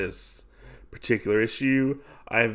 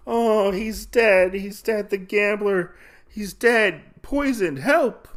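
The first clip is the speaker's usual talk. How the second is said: high-pitched mock alarm; imitated panic; 10 semitones higher, 3 dB louder than usual. Woman finding the gambler poisoned, high acted alarm. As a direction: cry out in a high put-on woman's voice.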